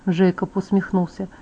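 usual speaking voice

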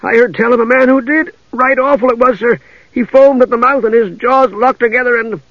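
high, fretful guard voice; high-pitched whimpering worry. Acted. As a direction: spill out a dreadful tale fearfully. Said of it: "the man whimpered".

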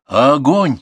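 loud, high command; battle yell. A narrator's reading. Grandson plays artillery commander against the raiders, shouting. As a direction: shout the order to fire. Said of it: "крикнул Артур".

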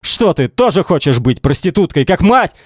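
high, mounting accusatory questions; shouting hatefully. A narrator's reading. Man taunts girl during a fight, shouting hatefully. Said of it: "кричит Эдди, ненавидя".

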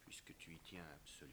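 soft whispered surrender; resigned whisper. Acted. Husband whispering as he gives in to his wife. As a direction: hush it, giving up.

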